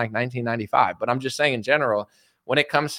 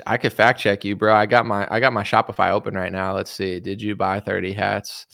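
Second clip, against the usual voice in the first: ordinary talk, nothing marked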